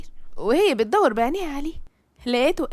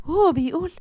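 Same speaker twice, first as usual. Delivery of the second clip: ordinary delivery, nothing marked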